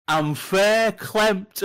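very drawn-out, loud and high Northern English; Northern English dialect. Retold or imitated. Hungry customer to baffled shop girl, exaggerated slow Northern English. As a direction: stretch each word in a broad Northern English accent. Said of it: "older Northern people have a lot of their own slang".

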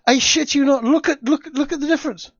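almost squealing at the picture, look at it; thrilled insistence; excited. Real, not acted.

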